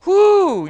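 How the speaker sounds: high, loud whoop; whoo. Real, not acted. Preacher whooping at the congregation, excited. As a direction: let out a sudden excited whoo. Preacher exclaims mid-sermon.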